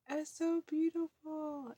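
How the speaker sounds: put-on mother calming kids at the top, slow soothing; slow soothing high voice. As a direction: linger on it to calm them. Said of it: "to calm them down".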